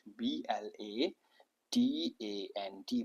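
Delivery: slow, soft letter-by-letter spelling; spelled out. Shown as a demonstration. Teacher spells blatant for the class, slow and soft. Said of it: "ब्लेटेंट".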